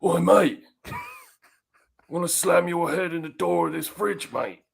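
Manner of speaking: hammed-up Cockney gangster threat. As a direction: bark it like a movie London heavy.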